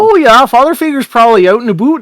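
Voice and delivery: lilting small-town officer voice; folksy Irish-Canadian officer. Acted. Folksy town officer telling kids where someone is, chatty. Game master plays Tracy Wiggletoe.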